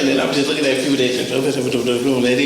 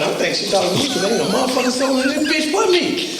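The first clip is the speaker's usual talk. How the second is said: laughing; 8 semitones higher, faster than usual. Real, not acted. Laughing retelling cab scene.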